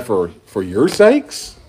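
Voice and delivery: slow, questioning rise on "sakes"; incredulous echo. Acted. Preacher playing a baffled disciple, incredulous. Repeats verse as baffled listener.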